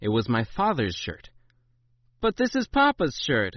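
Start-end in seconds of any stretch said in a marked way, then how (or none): 2.2-3.6 put-on high-pitched whiny child protest; complaining child. A narrator's reading. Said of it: "I complained".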